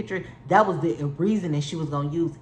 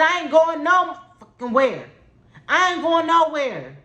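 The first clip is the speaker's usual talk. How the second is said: raised, loud defiant refusal; retold yell; 8 semitones higher, 4 dB louder than usual. Yelling at the people trying to move her car, defiant. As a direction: plant your feet and yell it.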